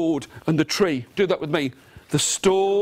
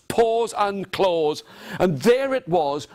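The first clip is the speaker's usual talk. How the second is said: usual speaking voice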